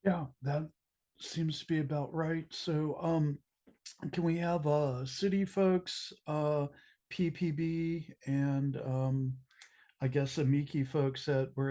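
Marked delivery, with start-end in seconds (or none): none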